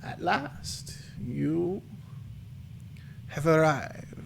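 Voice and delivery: high, soft, dry crone voice; crackly old crone. Acted. Old fortune teller greets the arriving party, crackly and high. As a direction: crackle like dry weeds, drawn out with pauses. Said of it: "her voice crackles. It's almost like dry weeds".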